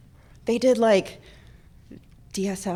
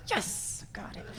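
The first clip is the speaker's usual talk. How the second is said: hushed, gleeful little hiss of victory; stealthy whispered celebration; whispered triumph; 14 dB quieter, slower than usual. Retold or imitated. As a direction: whisper it like a secret win. Retold gleeful secret triumph.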